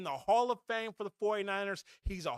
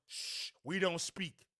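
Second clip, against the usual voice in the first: hushed, slow mob-style shush; a slow, hushed mafia warning; 4 semitones lower, 7 dB quieter, slower than usual. Retold or imitated. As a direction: hush them like a mob boss keeping secrets. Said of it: "built like the mob, the mafia".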